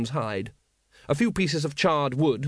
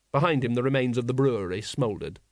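plain reading voice

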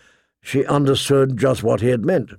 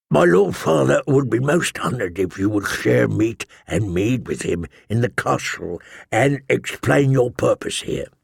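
put-on courteous old envoy; 3 semitones lower than usual. A narrator's reading. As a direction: invite them politely in an aged envoy's voice. Old envoy voiced in narration.

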